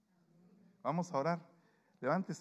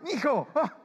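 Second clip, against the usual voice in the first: high, loud bellowing greeting; big son's greeting; 13 semitones higher, 10 dB louder, slower than usual. Retold or imitated. Preacher imitating his big son calling out in the parking lot, high and loud. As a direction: call out like an overeager big kid. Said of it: "cuando lo miraba yo".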